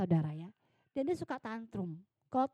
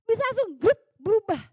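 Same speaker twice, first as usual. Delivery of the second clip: excited exclaim; 8 semitones higher, 10 dB louder, faster than usual. Real, not acted. Exclaiming sudden change.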